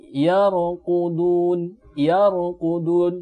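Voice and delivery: very slow yarqudun with a full, heavy ra; thick ra. Shown as a demonstration. Teacher reciting yarqudun slowly for students: thick ra. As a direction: stretch yarqudun, ra filling the mouth. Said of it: "dibaca dengan tebal".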